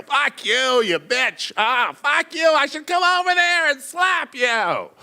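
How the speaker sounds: put-on high, shouting drunk rage; slurring, shouting drunk threat; drunk angry yelling. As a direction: yell it like a furious drunk. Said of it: "begins replying to her".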